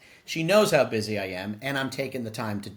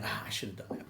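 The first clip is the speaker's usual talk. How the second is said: soft whispered regret, much softer; whispered thought; 3 semitones lower, 12 dB quieter than usual. Retold or imitated. Others' unspoken regret, voiced.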